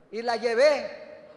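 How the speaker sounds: ordinary talk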